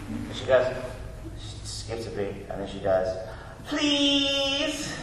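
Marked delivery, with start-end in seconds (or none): 3.7-4.9 put-on high, drawn-out little-kid plea; child's put-on please. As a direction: imitate a daughter laying on the sweetness. Imitates daughter's belated, put-on please.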